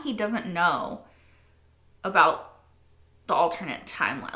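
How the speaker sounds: usual speaking voice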